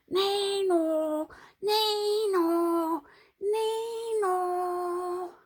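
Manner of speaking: high, loud frantic nay-no; panicked braying. A narrator's reading. Panicked cries when their hair stood on end. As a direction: bray in alarm.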